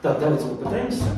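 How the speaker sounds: usual speaking voice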